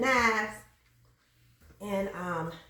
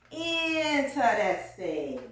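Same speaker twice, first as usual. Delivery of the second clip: very slow, stretched-out word; drawn-out emphasis; much slower than usual. Acted. Commenting on how her people are, drawn-out emphasis. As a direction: linger long on every syllable.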